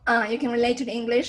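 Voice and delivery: ordinary delivery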